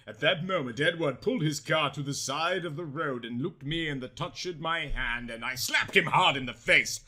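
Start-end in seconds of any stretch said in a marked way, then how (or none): none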